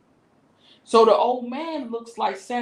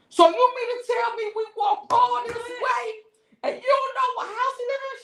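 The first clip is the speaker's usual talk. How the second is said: heated outburst. Retold or imitated. Yelling at her friend on the wrong block, incredulous. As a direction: yell at the friend in disbelief. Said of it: "Oh, I was heated".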